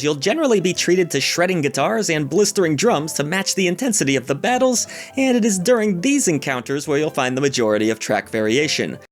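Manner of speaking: ordinary delivery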